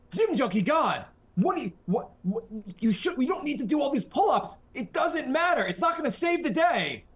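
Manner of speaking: high, loud mock-dramatic pleading; hammed-up movie drama. Podcaster improvising the Gym Junkie God plot, melodramatic. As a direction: argue in a strained high movie voice.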